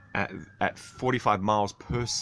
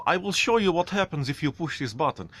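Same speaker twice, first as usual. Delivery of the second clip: Russian instructor; 7 semitones higher than usual. Retold or imitated. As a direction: bark it like the Russian flight instructor. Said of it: "He goes".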